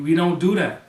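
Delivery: heated denial. Real, not acted. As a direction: put your foot down on it. Rising heat on the gang violence.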